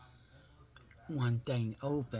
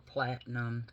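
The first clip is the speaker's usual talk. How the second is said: ordinary delivery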